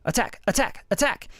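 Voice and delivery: high, snappy attack words; quick attack demo. Shown as a demonstration. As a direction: punch each attack fast. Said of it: "Fastest attack".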